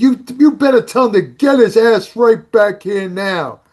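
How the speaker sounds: put-on loud, high, brash New York accent. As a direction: call out like a tough New York beauty queen. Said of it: "she has a big New York accent".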